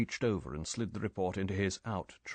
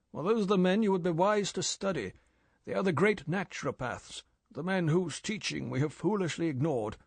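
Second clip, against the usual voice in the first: higher, eager lecturing voice; enthusiastic old chief; 6 semitones higher than usual. Acted. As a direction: preach with fresh zeal.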